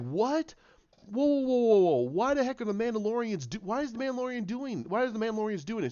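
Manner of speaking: high-pitched, incredulous outburst; acted astonishment. Onlookers spotting a Mandalorian fighting, acted astonishment. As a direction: play stunned onlookers, pitch jumping up. Acting out onlookers' stunned reaction.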